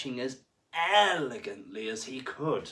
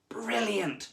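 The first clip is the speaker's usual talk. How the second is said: high excited shark voice; 11 semitones higher, 5 dB louder than usual. A narrator's reading. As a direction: burst out with glee. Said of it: "thought Sammy".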